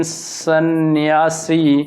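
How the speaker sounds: सन्यासी very drawn out, syllable by syllable; spelling demonstration. Shown as a demonstration. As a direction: dictate सन्यासी slowly as "san-yaa-si". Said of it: "वर्ग ऐसे होता है".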